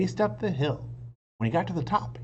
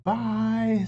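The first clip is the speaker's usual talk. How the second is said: put-on cheerful little girl; 10 semitones higher, 6 dB louder than usual. Annie's cheerful farewell.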